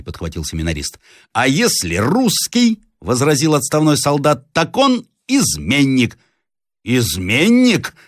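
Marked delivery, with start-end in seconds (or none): 1.3-2.8 high, slow, weighted challenge; pointed objection. Acted. Retired soldier countering the captive's defenders, pointed objection. 4.5-6.2 high, slow, deliberate verdict; accusing. Acted. Retired soldier declaring the captive a traitor, accusing. 6.8-7.9 slow, drawn-out indignant question. A narrator's reading. Captive repeating the soldier's accusation, indignant. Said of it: "повторил с негодованием Рославлев".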